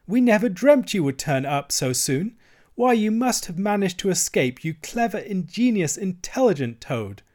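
high-pitched, delighted praise; gleeful admiration. A narrator's reading. Mole dances round escaped Toad, gleeful admiration. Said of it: "he began to dance round him".

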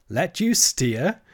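laughing. A narrator's reading.

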